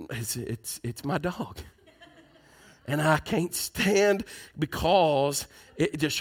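barely audible, voice dropping; a quiet admission, holding back tears; soft and choked. Real, not acted. Preacher admits emotionally, voice drops.

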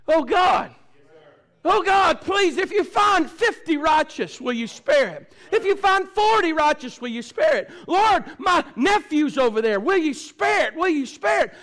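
Abraham begging God, high and loud, slow; pleading cry to God. Retold or imitated. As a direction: cry out for the city like a desperate intercessor. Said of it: "He cried out as an intercessor".

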